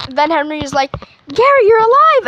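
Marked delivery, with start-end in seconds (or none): 1.3-2.3 high, excited reunion cry; mock joyful surprise. Henry finds his friend alive, mock joyful surprise. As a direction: exclaim it pitched up, in playful amazement.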